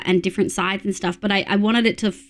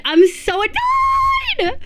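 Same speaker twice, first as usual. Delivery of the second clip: very high, drawn-out tantrum whine; exasperated wail stretched long and high; annoyed wail; much slower than usual. Real, not acted. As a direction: screech it out like a tantrum.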